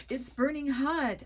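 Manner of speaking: high, loud cry of pain; shriek. Acted. Boy shrieking to Carly Beth about the burning mask, high and loud. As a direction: shriek that it burns. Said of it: "I shrieked".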